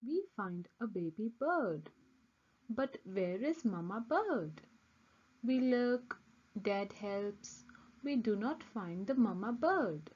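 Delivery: ordinary delivery, nothing marked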